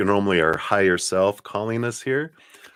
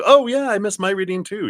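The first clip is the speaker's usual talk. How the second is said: sudden high, louder "oh yeah" of a viewer; mock realization; 14 semitones higher, 6 dB louder than usual. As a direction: play a viewer who just remembered. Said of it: "anyone else that was like".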